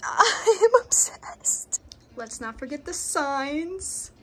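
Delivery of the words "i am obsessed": breathless gush. Real, not acted. Gushing over the finished cage.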